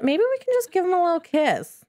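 high, playful flirting voice; flirty Sim voice. Pretend. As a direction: voice the Sim teasing a kiss. Voicing Sim's flirty thoughts.

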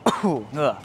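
coughing from spicy food; coughing fit. Real, not acted. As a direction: cough and groan after the hot sauce. Coughing after hot sauce.

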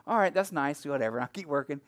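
low, quiet, dismissive brush-off; skeptical. Retold or imitated. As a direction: humor her, unconvinced, under your breath. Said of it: "like, skeptical".

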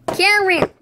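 high, loud shout of a name; sharp play-acted call. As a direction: holler to get a friend's attention. Calling an unresponsive friend.

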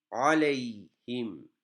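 "Aleyhim" drawn out slowly, each syllable clear; slow model reading. Shown as a demonstration. Teacher reading slowly for students to copy. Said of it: "Cezimlere dikkat edelim".

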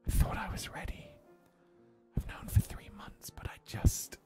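anguished breathy whisper; hushed, unvoiced-sounding stage whisper. A narrator's reading. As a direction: whisper it as a confession. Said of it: "Uki whispers".

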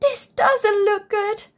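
high, worried "Oh dear"; a bear fretting to himself; worried bear thinking aloud. A narrator's reading. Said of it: "thought Bear".

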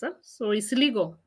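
ordinary delivery

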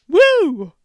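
loud, high cheer; excited whoop. Real, not acted. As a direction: whoop it out with joy. Celebrating Dunkaroos comeback.

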